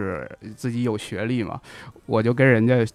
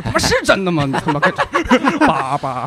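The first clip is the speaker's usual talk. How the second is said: much higher, louder mock retort cracking into laughter; laughing mock outburst; 6 semitones higher, 5 dB louder than usual. Real, not acted. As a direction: crack up while replaying the line. Retelling his bold on-stage retort. (大笑着模仿当时的反问)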